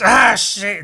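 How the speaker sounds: loud, high mock yelp; screaming ape. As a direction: cry out like a clawed ape. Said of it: "they're all screaming".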